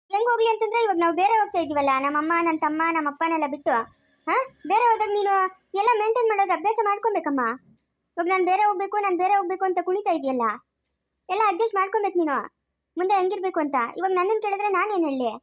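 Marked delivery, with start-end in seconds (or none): 0.1-1.9 angry scolding. Acted. Husband on the phone scolding his wife, angry. 4.7-15.3 angry scolding. Acted. Husband on the phone scolding his wife, angry.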